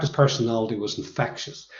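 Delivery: ordinary delivery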